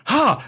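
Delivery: a single forced laugh sound, hammed up; group ha. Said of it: "everyone just starts going".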